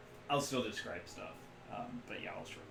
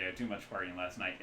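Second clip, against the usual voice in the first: ordinary talk, nothing marked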